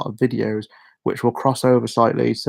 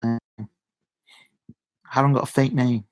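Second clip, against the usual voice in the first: ordinary talk, nothing marked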